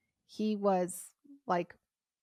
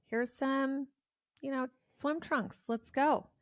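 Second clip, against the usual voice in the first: mock breezy host; 8 semitones higher than usual. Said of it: "So he was like".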